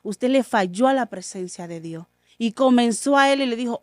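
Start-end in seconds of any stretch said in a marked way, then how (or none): 0.0-2.0 softly, calmly reassuring; a quiet, gentle father's promise; soft and serene, no anger at all. Retold or imitated. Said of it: "con toda la serenidad".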